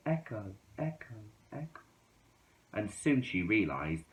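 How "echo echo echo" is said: soft, fast, far-off echo; distant echo. A narrator's reading. Two's yodel echoing back from the mountain, far away.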